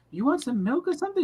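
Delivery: high motherly offer; a mother's caring high voice; Jamaican mother. Retold or imitated. Gavin's mother offering milk, high caring voice. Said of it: "Gavin's mother's like".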